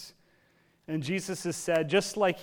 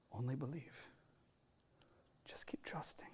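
near-whisper, much softer, fading to a breath; hushed reassurance; 3 semitones lower, 18 dB quieter than usual. Retold or imitated. Jesus whispering to grieving Jairus, hushed and tender. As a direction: whisper it close to his ear to steady him.

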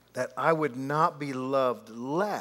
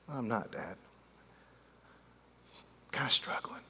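quiet, almost whispered admission; ashamed confession; 3 semitones lower, 9 dB quieter than usual. Retold or imitated. Son confessing to his dad, quiet and ashamed. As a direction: let it slip out barely voiced. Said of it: "he said".

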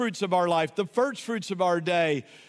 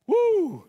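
excited whoop; 11 semitones higher, 5 dB louder than usual. Real, not acted. Preacher whoops mid-sermon.

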